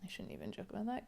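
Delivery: ordinary delivery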